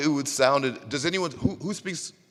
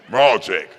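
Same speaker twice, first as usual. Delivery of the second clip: put-on loud, stern call to a boy; character catchphrase; 3 semitones lower, 7 dB louder than usual. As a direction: command it firmly in Russian. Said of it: "That almost sounds right in Russian".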